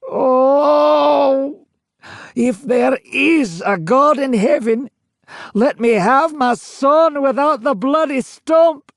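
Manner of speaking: high, loud, slow, crying out to heaven; anguished crying plea. A narrator's reading. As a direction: beg through tears with a century of grief. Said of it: "voice full of exasperation ... call out".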